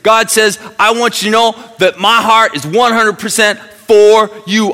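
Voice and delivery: loud, high fired-up pulpit voice; full-force preaching. Acted. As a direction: preach it out to the whole room.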